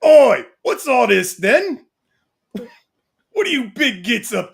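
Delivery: slow mock cockney bruiser toy; cheeky British toy. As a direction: play a rowdy British action figure. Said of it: "And he goes".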